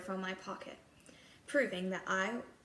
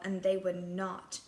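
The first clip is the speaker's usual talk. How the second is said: usual speaking voice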